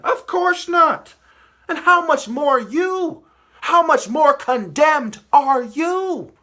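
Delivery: high, loud, drawn-out condemnation; slow, high-pitched righteous fury; indignant outcry. Real, not acted. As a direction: stretch each word in rising outrage. Preacher's indignant rebuke of hypocrites.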